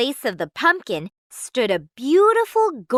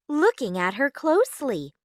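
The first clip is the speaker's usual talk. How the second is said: plain reading voice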